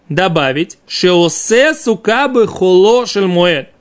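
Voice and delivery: normal talking voice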